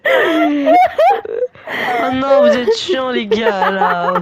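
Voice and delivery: loud, high laughing groan; exasperated laughing shout. Real, not acted. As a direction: yell it out half-laughing. Exasperated at villagers.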